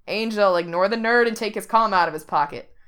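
loud shout back over his shoulder; loud casual shout. A narrator's reading. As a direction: call out loudly to the girl behind him. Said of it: "with a shout over his shoulder".

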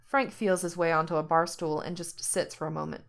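usual speaking voice

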